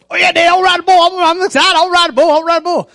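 put-on high, eager little-kid voice; mock excited boy. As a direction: gush like an excited twelve-year-old. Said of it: "He's all excited".

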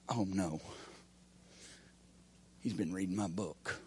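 a hint of a caught churchgoer muttering; mock guilty whisper. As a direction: play the squirming sinner in the pew. Voicing a guilty pew-sitter.

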